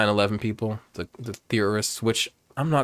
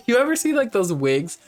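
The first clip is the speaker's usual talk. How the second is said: high-pitched, amused, through laughter; real giggling; 11 semitones higher, 4 dB louder than usual. Real, not acted. Streamer joking about a hat with a wig, giggling. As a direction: giggle into the question.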